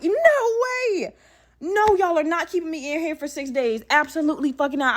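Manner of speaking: hammed-up outrage, starting at a squeal; going off. Patient refusing to be kept six days, going off. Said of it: "I started going off".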